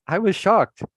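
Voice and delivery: ordinary talk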